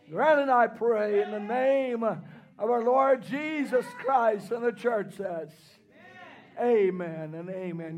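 low, soft prayer close; closing prayer. Acted.

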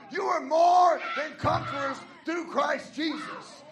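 loud, driving sermon build; preaching at full force. Acted. Pastor calling the church to believe, preaching loud.

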